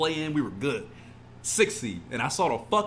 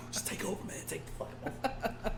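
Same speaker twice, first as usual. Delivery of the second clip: defeated murmur; 6 semitones higher, 10 dB quieter, faster than usual. Real, not acted. Drained fan giving up.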